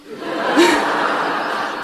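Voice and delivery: high, breathy burst of laughter; airy giggle. Real, not acted. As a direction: laugh at the punchline. Laughing at the posh curse.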